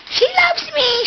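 "She loves me" up high with loves stressed; high pet-talk. Shown as a demonstration. Owner cooing to her corgi Emily, "She loves me" in high pet voice.

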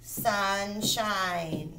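"Sunshine" softly stretched across both syllables; drawn out. Shown as a demonstration. Teacher writing a practice word, "Sunshine" softly drawn out. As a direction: draw out "Sunshine" quietly, joined up. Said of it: "How do I separate the syllables?".

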